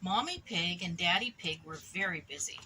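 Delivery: ordinary delivery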